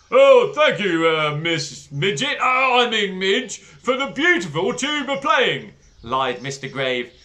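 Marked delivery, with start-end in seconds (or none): 0.1-5.7 loud, high, hesitant headmaster; flustered headmaster. Acted. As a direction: fake politeness, stumbling over names. Headmaster lying politely.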